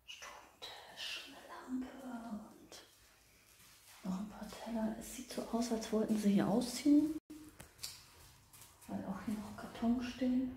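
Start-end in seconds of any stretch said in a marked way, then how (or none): 0.1-5.4 hushed whisper exploring a house; nervous night vlog whisper. Real, not acted. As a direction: whisper so nobody hears.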